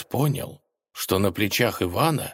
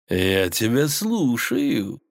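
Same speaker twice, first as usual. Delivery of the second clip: slow silky villain voice; insinuating; 4 semitones higher, much slower than usual. Acted. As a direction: purr it, slow and sly. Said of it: "вкратчиво сказал оборотень". (вкрадчиво)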